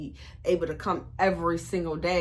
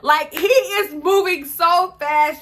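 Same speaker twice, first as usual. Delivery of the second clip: high, loud, slow exclaim at his speed; amazed; 8 semitones higher, 8 dB louder, slower than usual. Real, not acted. Telling the viewers how fast the boyfriend scrolled, high and loud in amazement. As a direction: marvel out loud, stretching it.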